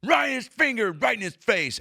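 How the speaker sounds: high-pitched mock raging yell; roid-rage shouting. Teller acting out the cop's outburst, raging. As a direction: act out a cop blowing up in someone's face.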